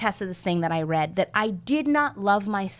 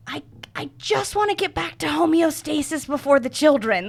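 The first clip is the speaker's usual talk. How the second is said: pleading inner voice; 8 semitones higher than usual. Retold or imitated. Retold inner plea.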